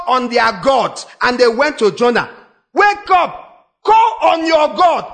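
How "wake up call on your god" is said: urgent shout. Retold or imitated. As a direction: bellow it to wake a sleeping man. Sailors waking Jonah in storm.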